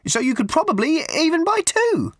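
put-on a prim, simpering old charity lady pushing tickets; high twittering church-fete lady; raffle woman. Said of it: "she said".

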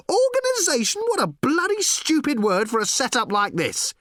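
very high-pitched indignant rant; incredulous outburst, pitch way up; outraged yell. A narrator's reading. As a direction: yell it in scornful disbelief. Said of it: "yelled Ford".

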